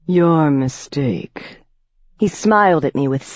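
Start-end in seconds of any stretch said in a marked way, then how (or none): 0.1-1.6 a predator savoring every word; menacing old vampire. A narrator's reading. Piscary replies.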